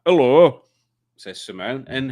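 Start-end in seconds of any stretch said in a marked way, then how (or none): 0.0-0.6 loud, higher northern hello; northern accent. Shown as a demonstration. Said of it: "That, like that".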